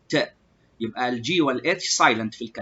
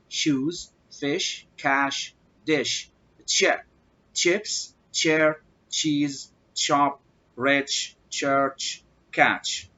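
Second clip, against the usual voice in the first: ordinary delivery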